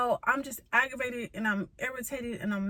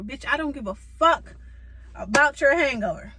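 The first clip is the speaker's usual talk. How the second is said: loud outraged telling-off; angry yell; 3 semitones higher, 7 dB louder than usual. Real, not acted. Talking to the viewers about the mechanic's hangover excuse, furious yell. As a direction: shout it, furious and fed up.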